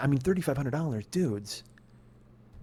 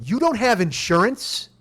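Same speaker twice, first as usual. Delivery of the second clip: raised, drawn-out incredulous demand; retold yell; 4 semitones higher, 4 dB louder, slower than usual. Confronting the uninsured driver beside her car, yelling. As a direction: yell it slowly in disbelief. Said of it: "not going to do anything except yell".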